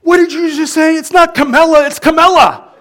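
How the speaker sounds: high, loud incredulous yelp; hammed-up Newsom shock; mock Newsom outrage. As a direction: correct him in loud disbelief. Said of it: "Newsom's over there going".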